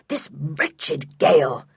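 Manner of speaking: slow, fierce grumble; irate man cursing the storm. A narrator's reading. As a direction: spit it out slowly, fiercely. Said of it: "he said fiercely".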